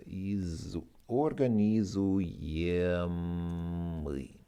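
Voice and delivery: organizujemli stretched slowly, each syllable clear; slow careful pronunciation. Shown as a demonstration. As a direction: drag out organizujemli for the learner. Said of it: "can be described using the adjective".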